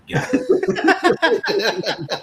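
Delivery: high, slow, stretched wail; mock anguished squeal; squeaky high wail. High-pitched drawn-out cry.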